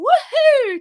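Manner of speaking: a high, loud playful woohoo. Shown as a demonstration. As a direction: throw your voice out like a kid. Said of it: "we're all gonna go".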